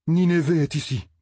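voix haute pour la mauvaise nouvelle; annonce-le avec alarme; annonce alarmée. Acted. Annonce une arrivée redoutée. (annonce alarmée, ton aigu)

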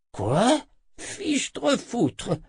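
high-pitched shocked outburst; stupéfaction. A narrator's reading. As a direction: exclaim in disbelief, voice shooting up. Z stupéfait par la nouvelle. (exclamation stupéfaite, très aiguë)

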